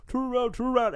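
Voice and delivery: high-pitched mock warning; Randy. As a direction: imitate frantic calling at the screen. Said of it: "he's like, saying".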